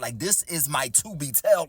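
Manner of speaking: plain reading voice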